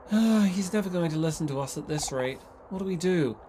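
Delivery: ordinary delivery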